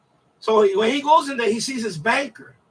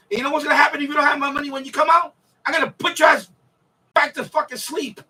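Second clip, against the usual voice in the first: mock gangster threat; 5 semitones higher than usual. Gangster threatening the banker over his money, hammed-up fast threat.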